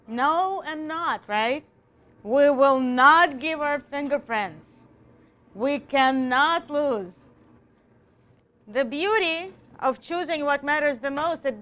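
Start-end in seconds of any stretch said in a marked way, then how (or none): 2.2-4.7 "not" pressed hard and slow; stress on not. Shown as a demonstration. Teacher stressing the word no for the class. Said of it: "the words that he repeated the most and emphasized". 5.5-7.3 "cannot" drawn out, very slow; stress on cannot. Shown as a demonstration. Teacher slowing down to show the stress. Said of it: "the words that he repeated the most and emphasized".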